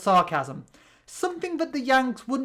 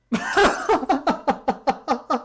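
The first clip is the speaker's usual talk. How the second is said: cartoon laugh; 5 semitones higher than usual. Character laugh between dialogue lines.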